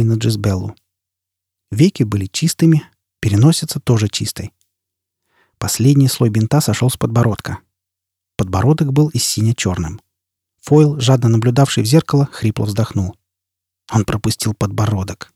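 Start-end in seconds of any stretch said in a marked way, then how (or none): none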